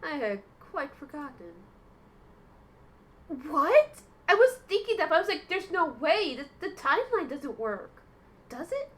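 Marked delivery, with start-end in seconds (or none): 3.5-8.9 loud and higher, disbelieving; loud shocked rant at the story twist; stunned outburst. Real, not acted. Stunned reaction to plot twist.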